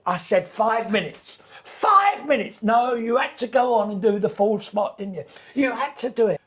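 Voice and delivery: loud, high, exasperated telling-off; angry manager. Retold or imitated. Manager scolding the comedian, loud and exasperated. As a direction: scold him for overrunning.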